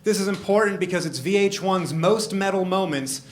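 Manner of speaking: normal talking voice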